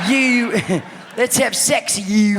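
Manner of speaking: high, loud Scottish "You", held long; giggly, exaggerated Scottish accent; fake Scottish accent. Shown as a demonstration. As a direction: stretch "You" in mock Scottish. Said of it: "you have to hold out".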